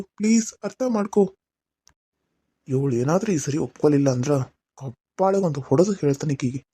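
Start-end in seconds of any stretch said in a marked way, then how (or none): 2.7-6.6 much lower voice in North Karnataka dialect; low threatening aside. Acted. Aside in another voice. (ತಗ್ಗು ಧ್ವನಿಯಲ್ಲಿ ಬೆದರಿಕೆ)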